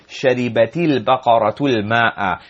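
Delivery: "شربت البقرة الماء" as one linked phrase, sharibati-l-baqaratu-l-ma'a; words joined. Shown as a demonstration. Teacher repeats the joined reading, "شربت البقرة الماء" linked. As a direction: link each word of "شربت البقرة الماء" into the next. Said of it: "joining there".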